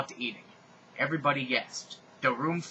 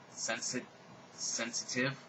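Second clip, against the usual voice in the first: ordinary delivery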